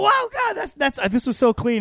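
high-pitched, gushing wonder; hammed-up awe; mock amazement. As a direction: act blown away, voice leaping up. Acted amazement in sponsor anecdote.

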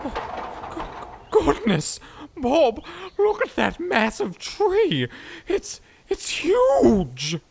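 slow, high and amazed; awestruck. Acted. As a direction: gasp in wonder at the huge tree. Awed at the giant tree.

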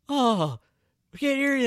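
high, loud cheesy crowd call; cheesy hype line. Retold or imitated. Cohost mocking a worn-out crowd call, high and loud. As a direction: ham up the tired crowd trick. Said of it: "the old fatal".

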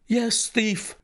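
high, angry retort; understated roar. A narrator's reading. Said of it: "I roared".

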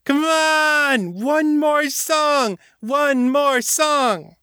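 very high, slow chanted demand; drunken heckling shout. A narrator's reading. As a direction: drag out each cry for an encore. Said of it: "she shouted".

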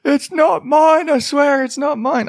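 high-pitched mock British protest; British accent. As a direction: plead innocence in a silly, high British voice. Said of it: "she suddenly became British".

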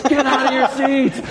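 high, laughing mock yell at the crowd; retold yelling. Friend yells at the theater audience to get up, high laughing yell. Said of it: "he starts yelling at everybody".

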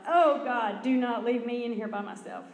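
retold panic climbing high and loud; panicked out loud. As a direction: wail it in mock terror, half laughing. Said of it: "I just said out loud".

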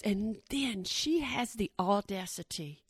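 slow, low "audacity" for emphasis; audacity stressed slowly. Real, not acted. Telling the audience, slow low emphasis. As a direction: lean hard on "audacity", telling the crowd. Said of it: "Listen to what I'm telling you".

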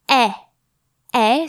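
teacher models an open E with the mouth wide open; open E sound. Shown as a demonstration. Scene: French teacher showing students the open è, mouth wide open. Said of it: "lips ... quite open".

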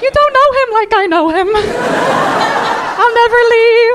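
high, loud, hammed-up devotion; defensive abused partner. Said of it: "in an internationally domestic abusive relationship".

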